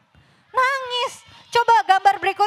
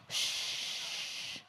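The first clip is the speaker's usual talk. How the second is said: a long whispered shh; shushing; 20 dB quieter than usual. Real, not acted. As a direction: hush the class with a breathy shh. Hushing noisy children. (mendesis menyuruh diam)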